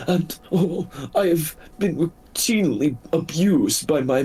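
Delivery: high, quick, whimpering wolf voice; a frightened injured wolf pleading; timid beast in a high voice; pitiful wolf. Acted. Wolf introduces itself.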